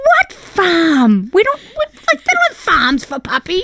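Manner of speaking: high, loud disbelief; baffled and exasperated; incredulous. Retold or imitated. Wife baffled by husband's farm idea, high loud disbelief.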